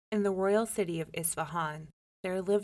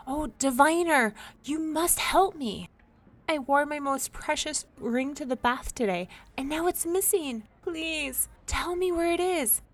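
much higher, desperate plea; pleading lady; 8 semitones higher, 4 dB louder than usual. A narrator's reading. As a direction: beg for help, high and anxious.

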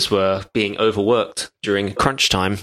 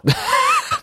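sudden squeaky burst of laughter; high laugh. Real, not acted. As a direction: let a high laugh escape. Guest laughing at the joke.

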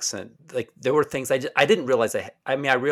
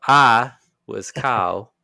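slow Southern drawl on the name; Southern accent; 2 semitones higher, 8 dB louder, much slower than usual. Shown as a demonstration. Demonstrating his old self to the hosts, slow Southern accent. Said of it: "had a Southern accent".

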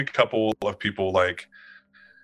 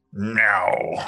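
meow like no. Acted. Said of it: "the meow sounded like".

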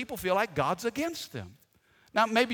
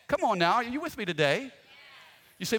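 ordinary talk

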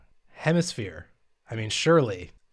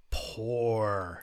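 long, drawn-out Australian "Poor" with no R; Australian-accented "Poor", stretched out; full Australian accent; 5 dB quieter than usual. Pretend. Reviewer handing down a bad rating, full Australian accent. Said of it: "you've gone full Australian".

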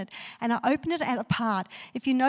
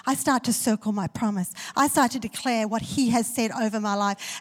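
normal talking voice

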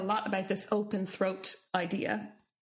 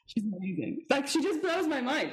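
gushing awe; 4 semitones higher, slower than usual. Real, not acted. Gushing about lecturer.

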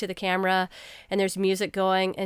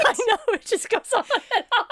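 high laughing talk; 12 semitones higher, faster than usual. Real, not acted. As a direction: giggle through the words. Laughing at bad captions.

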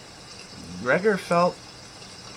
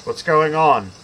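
louder, higher father's call; 4 semitones higher, 7 dB louder than usual. A narrator's reading. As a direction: demand an answer through the door. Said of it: "he called out".

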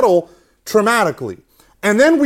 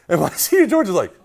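normal talking voice

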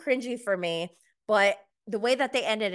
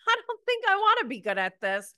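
much higher and fast mock voice; Kate imitated; 11 semitones higher, 3 dB louder, faster than usual. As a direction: rattle it off in a high put-on voice. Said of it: "She goes".

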